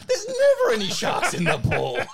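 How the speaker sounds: squeaky voice through laughter; laughing high squeal. Retold or imitated. Laughing mock outrage.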